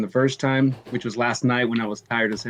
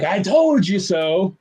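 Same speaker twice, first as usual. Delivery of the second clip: higher, drawn-out I-told-you-so; gloating; 6 semitones higher, 3 dB louder, much slower than usual. Acted. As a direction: stretch it out as a playful gloat. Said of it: "just be like".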